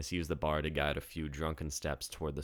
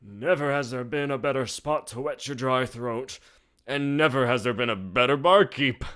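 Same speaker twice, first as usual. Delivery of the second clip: put-on old barkeep voice; 9 semitones higher, 9 dB louder than usual. A narrator's reading.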